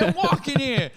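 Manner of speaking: high put-on New Yorker shout; mock New York yell. Pedestrian yells the classic line at the cab, mock New York accent.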